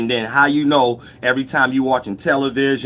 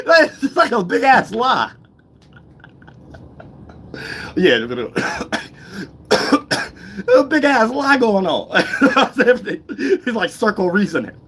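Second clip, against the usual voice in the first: high-pitched, laughing through the words; real helpless laughter while talking; cracking up; 8 semitones higher than usual. Real, not acted. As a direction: barely get it out for laughing. Cracks up at the big lie.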